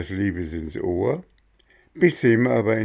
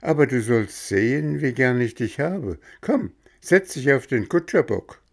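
higher, louder sweet coaxing; coaxing coachman; 6 semitones higher, 3 dB louder than usual. Acted. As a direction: cheer the sad boy up to lure him. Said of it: "sprach das Männchen".

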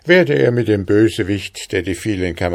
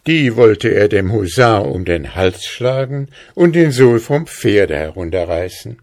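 ordinary delivery, nothing marked

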